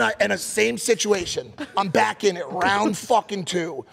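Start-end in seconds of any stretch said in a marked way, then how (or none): none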